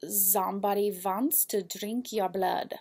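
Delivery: slow, hammed-up Ukrainian accent on the yarn name; playful Ukrainian accent, drawn out; mock Ukrainian accent. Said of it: "put a Ukrainian accent on that".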